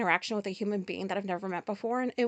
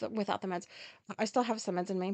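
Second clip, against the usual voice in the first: usual speaking voice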